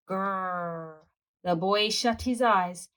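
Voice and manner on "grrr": low, quiet mock growl; soft growl. A narrator's reading. Dinosaur growling at the boy in bed. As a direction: growl softly from the dark.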